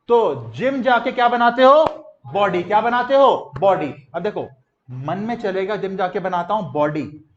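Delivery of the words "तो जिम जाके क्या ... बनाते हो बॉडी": loud call-and-response drill; teacher shouting questions to the class; loud quizzing. Real, not acted.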